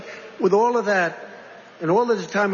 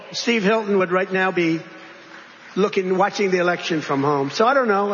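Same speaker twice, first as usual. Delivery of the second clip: ordinary talk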